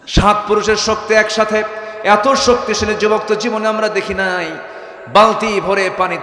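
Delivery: high, loud sermon cry; impassioned high-pitched preaching; full-force preaching. Acted. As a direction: raise the voice and proclaim it to the crowd.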